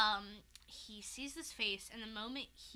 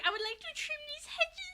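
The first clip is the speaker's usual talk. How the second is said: high, whimpering mock-polite voice; meek Luke; 4 dB louder than usual. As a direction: squeak it like a lost innocent. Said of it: "Luke is like".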